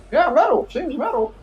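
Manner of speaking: crowd member voice. Acted. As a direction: squeak it out like an amazed bystander. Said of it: "they're like".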